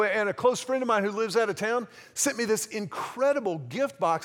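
usual speaking voice

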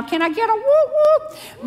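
high, playful call for a cheer; rally the room like a hype man; whoop whoop cheer. Real, not acted. Celebrating being empty nesters.

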